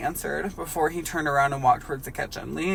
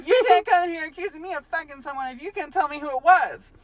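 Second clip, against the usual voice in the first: much higher cartoon voice breaking into laughter; laughing SpongeBob voice; 14 semitones higher, 6 dB louder than usual. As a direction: try to stay in the voice while cracking up.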